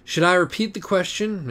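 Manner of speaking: high, imperious princely voice; haughty pony prince. Acted. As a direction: talk down to him like a regal prince. Acorn speaks, pony character.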